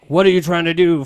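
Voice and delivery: loud exasperated yell. Acted. Han scolds Chewie over the hyperdrive, loud exasperated. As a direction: bellow the question up at him. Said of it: "Han yells".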